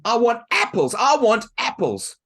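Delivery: high, loud whiny demand; spoiled girl demanding. Acted. Helen demanding apples from mum, loud whine. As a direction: chant it like a brat.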